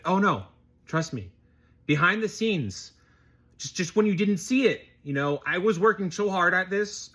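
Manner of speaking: high-pitched, flustered mock self-justification; defensive excuse-maker. Mimics powerful people's future excuses.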